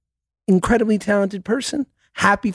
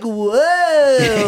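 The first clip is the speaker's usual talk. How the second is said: California surfer; 4 semitones higher than usual. Retold or imitated. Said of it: "He should be talking like".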